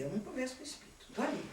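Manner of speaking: a quick quiet grumble; muttered aside. Retold or imitated. Muttered aside about not talking.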